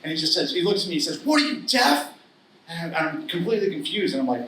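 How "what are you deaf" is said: mock-furious dad shouting at his son; angry yell. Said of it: "he's just pissed".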